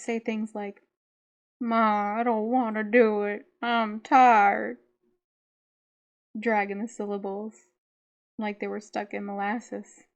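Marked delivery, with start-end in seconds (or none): 1.6-4.8 louder, slow slurred Southern drawl; syrupy country drawl. Retold or imitated. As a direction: drag the syllables like a lazy country boy. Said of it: "slip into this thick, syrupy drawl".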